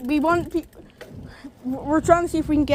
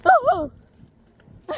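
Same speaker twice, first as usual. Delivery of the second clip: surprised yelp; 12 semitones higher, 3 dB louder than usual. Real, not acted. Riding, reacting to bike sounds.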